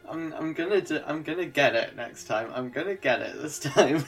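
usual speaking voice

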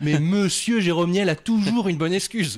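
upbeat presenter read; show host intro. Acted. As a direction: hype up the guest like a host.

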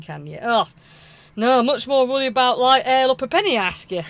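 hammed-up Northern grumbling; acted wifely annoyance, emphatic; exasperated Northern wife. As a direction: blame him for the mistake. Wife rants in sketch.

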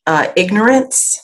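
ordinary delivery

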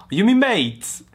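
high, loud mock-incredulous question; mock character question. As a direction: blurt it out high, hamming it up.

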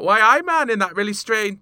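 high, loud Geordie impression; Geordie accent. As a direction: put on a broad Geordie accent. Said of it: "she said it in a Geordie accent".

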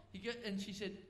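ordinary talk

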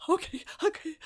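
high-pitched mock whimper; tearful compliance. Shaken receptionist obeying her boss, high mock-tearful whimper. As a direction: squeak out agreement, acting shaken.

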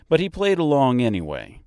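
ordinary delivery, nothing marked

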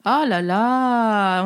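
very slow mock dismay; drawn out oh là là. Real, not acted. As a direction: stretch it out slowly, mock-despairing. Teasing dismay. (oh là là traîné, faussement consterné)

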